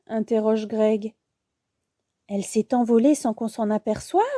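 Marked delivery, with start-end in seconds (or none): none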